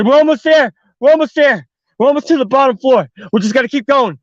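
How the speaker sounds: high, loud, pushing her on; urgent encouragement. Retold or imitated. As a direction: keep her moving, urgently. Pulling her down the stairs.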